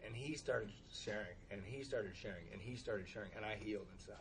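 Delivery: low, very soft, repetitive murmur; hushed, soothed. Real, not acted. Hushed account of others sharing.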